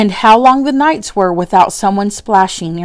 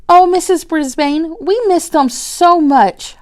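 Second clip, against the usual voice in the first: put-on pleading child; 8 semitones higher than usual.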